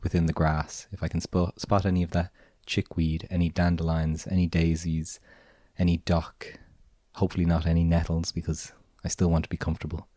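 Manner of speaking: ordinary talk, nothing marked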